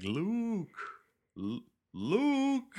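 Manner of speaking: a hint of an impatient Obi-Wan calling; impatient Ben. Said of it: "He sounds kind of impatient".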